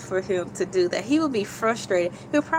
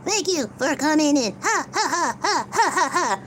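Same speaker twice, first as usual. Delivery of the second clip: mock laugh-crying; 6 semitones higher than usual.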